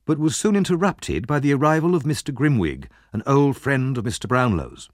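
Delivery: plain reading voice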